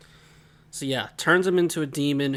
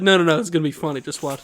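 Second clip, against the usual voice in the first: louder, brushing off the worry; lightly voiced dad pushing his prank; insistent father; 2 semitones higher, 7 dB louder, much faster than usual. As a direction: talk him into it, loudly. Acts out father dismissing his worry.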